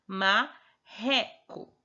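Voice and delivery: marreco with a stretched throaty rr; sounding out. Shown as a demonstration. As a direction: marreco drawn out very slowly, the rr a long h sound. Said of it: "Aí tem dois R's aqui".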